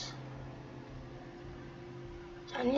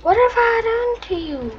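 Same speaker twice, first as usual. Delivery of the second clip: mock whining; 4 semitones higher than usual. Real, not acted. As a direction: whine at the chaser. Whining at game chaser.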